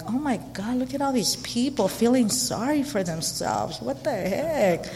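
soft scornful mutter; quiet judgmental aside. Retold or imitated. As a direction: judge them under your breath. Retold judgmental inner thought.

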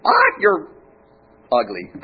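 pet-store parrot's shrill call; squawking parrot. Acted. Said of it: "the parrot goes".

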